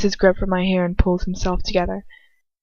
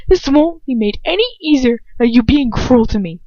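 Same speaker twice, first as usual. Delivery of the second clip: higher, loud, hurt plea; passionate girl's voice; 5 semitones higher, 5 dB louder than usual. A narrator's reading. Girl rebuking Lucius, high and hurt. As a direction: throw it at him passionately. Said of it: "I whisper passionately".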